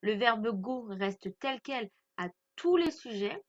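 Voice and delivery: normal talking voice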